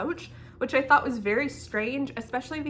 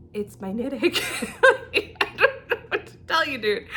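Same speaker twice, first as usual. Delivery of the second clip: laughing disbelief; 3 semitones higher, 4 dB louder than usual. Retold or imitated. Second time, laughing at absurdity.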